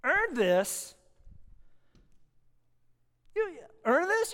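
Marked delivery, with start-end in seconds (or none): 3.3-4.3 incredulous. Real, not acted. Mocking the line.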